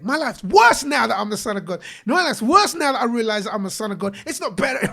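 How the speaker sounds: hammed-up indignant griping, punching the word worse; mock complaining. Imagining the Savior grumbling like him, loud mock complaint.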